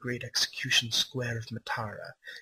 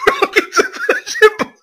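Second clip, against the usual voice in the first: loud reading that breaks into laughter; higher, louder, cracking up mid-sentence; laughing while reading; 13 dB louder than usual. Real, not acted. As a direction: fight back the giggles as you read. Reading a gory line aloud.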